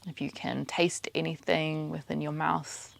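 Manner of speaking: soft, even meditation voice, spaced out with pauses; quiet, unhurried mindfulness cueing; guided meditation. Acted. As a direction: guide their attention gently through the senses. Leads a meditation.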